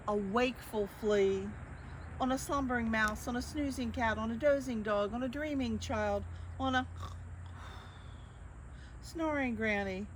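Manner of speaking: ordinary delivery, nothing marked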